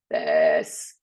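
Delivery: very low creaky vocal fry. Shown as a demonstration. Voice teacher showing students an unhealthy habit, low vocal fry. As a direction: let the word sink into a drawn-out creak. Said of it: "It's called vocal fry".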